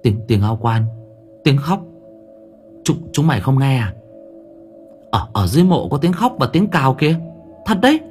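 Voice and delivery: put-on stammering scared boy, pitch climbing; spooked child insisting on what he heard; frightened. A narrator's reading. Scared boy reports crying from grave.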